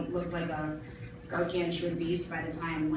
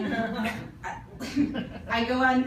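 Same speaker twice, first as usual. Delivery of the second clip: ordinary talk, nothing marked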